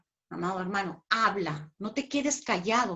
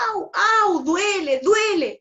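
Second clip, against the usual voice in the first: high, loud imitated yelp of pain; body crying out in pain; mock cry of pain; 8 semitones higher, 6 dB louder, slower than usual. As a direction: whine like a hurting body, hammed up. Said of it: "Cuando tu cuerpo dice".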